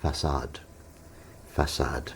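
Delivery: FACADE as "fuh-SAHD", low and deliberate; correct pronunciation. Retold or imitated. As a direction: model FACADE with a soft s and an ah. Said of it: "it's pronounced".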